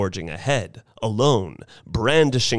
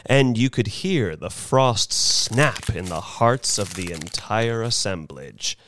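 ordinary delivery, nothing marked

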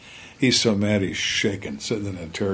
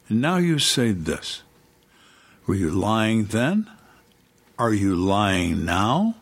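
slow, drawn-out mock accusing questions; menacing turn; slower than usual. Interrogator confronting a suspect over changed story, menacing. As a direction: act the nice cop turned Antichrist, deliberate and slow. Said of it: "change from Mister Nice Guy to the Antichrist".

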